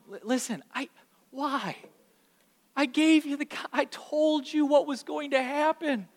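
high, halting, pleading explanation; reasoning parent. Acted. Parent talking to calmed child, high pleading explanation. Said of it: "you try to talk to 'em".

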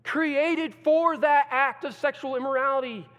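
loud, high preaching at full force; preacher thundering about Rome; fiery preaching. Acted. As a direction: drive it home from the pulpit.

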